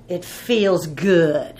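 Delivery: drawn out and relishing it, lightly voiced; a hint of a man enjoying his anger; slow relish. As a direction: savour each word of the grudge slowly. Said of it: "That guy was mad, boy".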